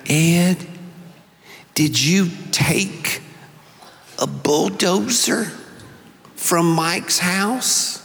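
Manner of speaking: slow, soft Mississippi mother's voice; lightly voiced Mississippi mom; Mississippi accent. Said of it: "she said in her Mississippi accent".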